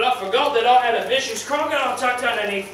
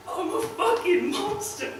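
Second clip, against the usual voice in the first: anguished cry; 11 semitones higher, 4 dB quieter than usual. Acted. Self-loathing realisation.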